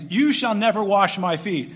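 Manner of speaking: slow, loud proud protest as Peter; a lightly voiced proud Peter refusing; proud exclaim. Peter refusing to let Jesus wash his feet, lightly voiced proud protest. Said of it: "You hear the pride in his voice".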